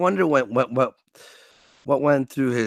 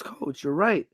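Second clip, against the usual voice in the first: ordinary talk, nothing marked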